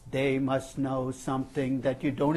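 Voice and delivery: slow, even-pitched, low monotone; monotone inner voice. Acted. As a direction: drone it out slowly on one note. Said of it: "It speaks in a monotone".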